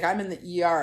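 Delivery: ordinary talk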